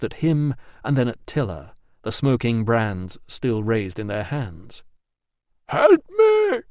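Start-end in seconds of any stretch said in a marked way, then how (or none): 5.7-6.6 very high, loud desperate cry; cry for help. A narrator's reading. Trapped man crying for help from the malt house. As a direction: scream it from inside a locked room. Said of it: "cried the voice".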